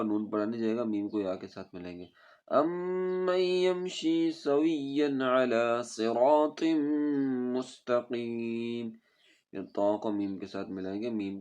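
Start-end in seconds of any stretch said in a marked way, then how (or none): 2.5-8.9 melodic chanted tajweed recitation; Quran recitation. Shown as a demonstration. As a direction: recite slowly and musically, stretching vowels.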